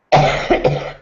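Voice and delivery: harsh dry cough; coughing fit. Real, not acted. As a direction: cough hard into the mic. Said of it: "Sorry I'm coughing so much".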